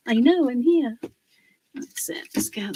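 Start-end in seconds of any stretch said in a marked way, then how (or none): 1.7-2.7 whispered aside; soft whispered that's it. Real, not acted. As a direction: murmur it to yourself, unvoiced. Quiet aside while fitting piece.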